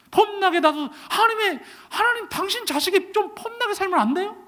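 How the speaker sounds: high-pitched, whining plea; pleading complaint. Retold or imitated. Pastor begging God for a better life, high whining plea. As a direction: beg like a frustrated son.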